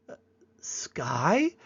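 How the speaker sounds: hesitant, lightly voiced child; child naming the sky. First child points to the sky, tentative naming. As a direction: grope for the word, then offer it as a question. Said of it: "points to the sky and goes".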